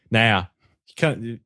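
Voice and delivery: ordinary talk, nothing marked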